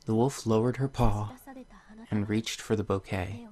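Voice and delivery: soft, gentle storybook reading; story narration. Acted. Reading a tale to viewers, soft storybook voice. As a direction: read the fairy tale softly to the viewers. Reads the game's story aloud.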